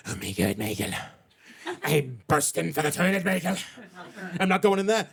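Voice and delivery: a hint of a wandering Irish accent teasing Michael. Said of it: "That accent took a bit of a European tour".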